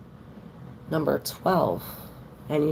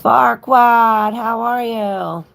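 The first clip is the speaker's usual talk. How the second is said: loud greeting; 9 semitones higher, 9 dB louder, much slower than usual. Real, not acted. Greeting a viewer by name.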